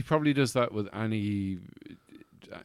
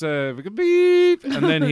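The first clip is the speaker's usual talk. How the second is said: bleep voice; 13 dB louder than usual. Pretend. Imitating bleep.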